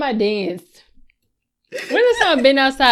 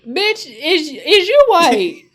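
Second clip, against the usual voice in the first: slow, louder, incredulous retort; sassy comeback; 6 semitones higher, 8 dB louder, slower than usual. Retold or imitated. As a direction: snap back in disbelief. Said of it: "I be like".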